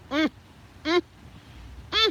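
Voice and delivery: short, separated "mm"s of admiration; admiring "mm". Real, not acted. Fan thinking of actor, spaced appreciative "mm"s. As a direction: savor each little "mm" with a gap after it. Swooning over Idris Elba.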